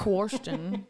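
"Question" pronounced "kworsh-ton"; Southern man's pronunciation. Retold or imitated. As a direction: imitate him saying "Question" as "kworsh-ton". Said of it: "but he always said".

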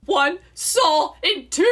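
drawn-out, high swoon over the line; swooning echo. Real, not acted. Friend swooning over the romantic quote, high and slow. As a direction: melt as you repeat it.

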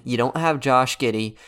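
normal talking voice

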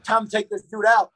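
high, loud re-enacted yell; retold yell. Wrestler reliving his shout before leaving the back room, yelling. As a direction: yell it out high, as if psyching yourself up. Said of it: "I guess I yelled".

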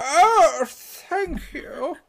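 high, thin grandma voice; old lady voice. Retold or imitated. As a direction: play a sweet old grandma.

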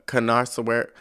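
ordinary talk

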